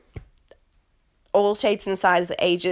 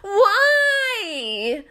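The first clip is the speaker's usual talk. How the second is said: high, loud exasperated why; exasperated cry; 12 semitones higher, 4 dB louder than usual. Real, not acted. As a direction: throw the question up high. Exasperated outburst.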